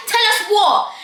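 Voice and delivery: loud, high challenge; demanding. Retold or imitated. Best friend confronting Jacob and the girl, demanding. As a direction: imitate a fed-up demand for answers.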